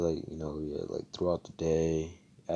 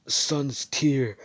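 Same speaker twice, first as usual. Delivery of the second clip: shouted spell name; 7 semitones higher, 6 dB louder than usual. Retold or imitated. Hero casts a spell.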